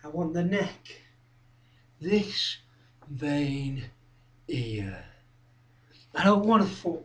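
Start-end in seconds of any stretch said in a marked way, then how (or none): 0.0-1.0 low, soft threat; softly stated want, held back; quiet menace. Acted. First, menacing take. 2.0-5.1 low, soft, word by word; hushed, halting, deliberate threat; quiet menace. Acted. Indicating the vein.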